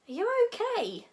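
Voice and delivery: very high, anxious question; concerned friend. A narrator's reading. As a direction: squeak it out with concern. Worried friend asks after bite.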